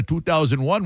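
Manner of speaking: usual speaking voice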